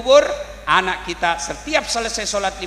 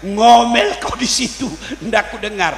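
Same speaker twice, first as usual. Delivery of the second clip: taunting down the well, slowing and swelling on the second Ngomel; a touch of smug revenge; mocking husband; 2 semitones lower than usual. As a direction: gloat at the nagging wife below. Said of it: "Kata suaminya".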